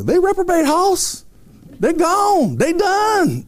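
put-on high-pitched Southern preacher accent; Tony Hudson. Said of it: "a southern accent. He's like".

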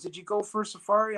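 retold friendly question. Supervisor's friendly small talk, retold.

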